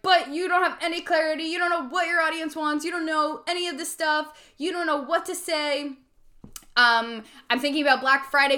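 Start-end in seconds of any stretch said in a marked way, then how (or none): 0.0-5.9 higher nagging inner critic; high voice picking at her doubts; doubtful inner voice. Retold or imitated. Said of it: "the other part of me is like".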